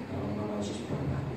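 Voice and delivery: whispered under the breath; quiet prayer. Shown as a demonstration. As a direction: pray it in a hushed whisper, no shouting. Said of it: "nggak usah teriak-teriak". (doa berbisik pelan)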